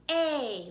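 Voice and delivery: A said as the letter name, like ate; long A sound. Shown as a demonstration. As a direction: hold out A as in ate. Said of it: "A says its long sound".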